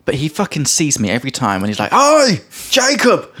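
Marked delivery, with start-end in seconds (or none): 1.9-3.3 high, drawn-out mock shout; imitated yell. Food seller yelling to a passing customer, mock shout. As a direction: holler it like someone far away, without full force. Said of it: "screaming at the top of his lungs".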